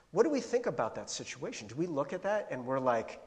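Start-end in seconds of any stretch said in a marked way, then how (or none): none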